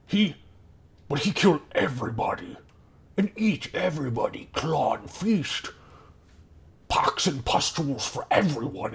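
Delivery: high, halting, incredulous goblin voice; older goblin. Acted. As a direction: marvel haltingly as an old goblin. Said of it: "the older one goes".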